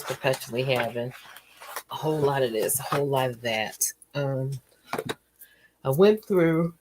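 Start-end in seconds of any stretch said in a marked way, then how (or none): 5.8-6.7 words stretched by a yawn. Real, not acted. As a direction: talk through a yawn. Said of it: "excuse me for yawning".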